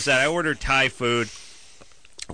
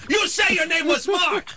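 restaurant worker. Acted. Mimics restaurant worker on phone.

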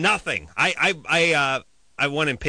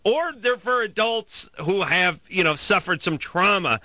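worked-up rant; 9 semitones higher than usual. Real, not acted.

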